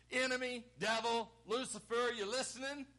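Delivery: challenging, punched address to a foe; taunting challenge. Acted. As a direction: call out the enemy by each title. Voices Jesus taunting the devil.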